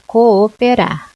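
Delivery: cooperar slowly, each O its own syllable. Shown as a demonstration. Teacher repeating the split word for students. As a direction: spell cooperar out by sound. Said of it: "eu posso separar".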